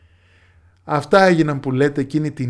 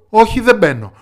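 loud, high-pitched refusal; stubborn daughter; 8 semitones higher, 8 dB louder than usual. A narrator's reading. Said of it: "έλεγε η κόρη". (πεισματάρα κόρη που αρνείται δυνατά)

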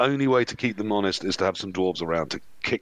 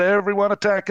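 shouted battle cry; 9 semitones higher, 4 dB louder than usual. Retold or imitated. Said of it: "And Richard the Bag said".

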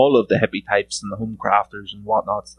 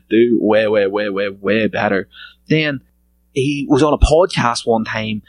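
ordinary talk

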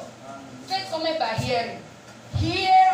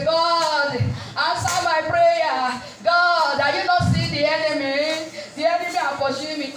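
mock lamenting prayer; 2 semitones higher, 4 dB louder than usual. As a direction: plead noisily, stretching out the cries. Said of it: "You are just lamenting, making noise before God".

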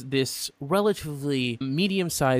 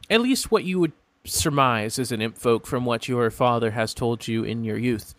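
ordinary talk

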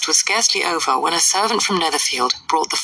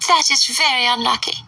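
high, fluttery mother's voice; pitched-up huffy matron; fussy Mrs Bennet; 8 semitones higher than usual. A narrator's reading. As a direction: play the indignant silly mother.

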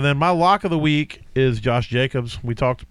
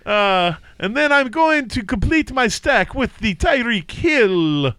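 much higher hammed-up mock Italian with a vampire tinge, dragging out D J Moore; Transylvanian Italian accent; 9 semitones higher than usual. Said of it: "my Transylvania Italiano".

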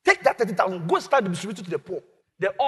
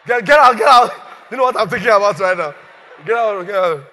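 loud, fed-up yell; angry shout; 9 dB louder than usual. Acted. Faithless father shouting his son away angrily. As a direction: bark them off.

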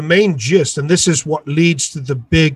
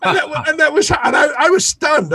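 talking through real laughter, high pitched; high, giggly recovery from a laugh; 10 semitones higher than usual. Real, not acted. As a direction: still laughing as you get the words out. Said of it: "I laugh about it now".

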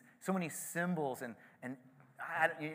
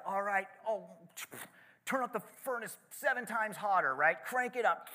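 higher-pitched mock rage; mad king; 7 semitones higher than usual. King ordering the furnace heated, hammed-up rage. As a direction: bark the order like an enraged king. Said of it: "the king gets so mad after this".

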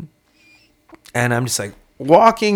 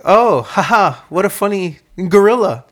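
higher, louder put-on cheerful laugh; fake amusement; 8 semitones higher, 6 dB louder than usual. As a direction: fake delight to stall for time. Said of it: "And I was just like".

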